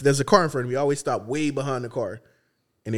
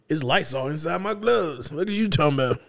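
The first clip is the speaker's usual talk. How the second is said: slow, put-on janitor voice; 4 semitones higher, slower than usual. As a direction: play the unbothered janitor. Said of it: "He said".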